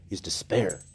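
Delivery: ordinary delivery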